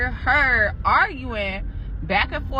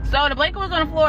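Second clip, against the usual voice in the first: higher, louder and fast, driving the point home; emphatic repeat; 7 semitones higher, 4 dB louder, faster than usual. Real, not acted. Storyteller repeating the spooky blanket detail for emphasis, excited. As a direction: hit it again like it matters.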